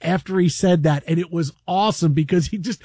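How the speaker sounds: ordinary talk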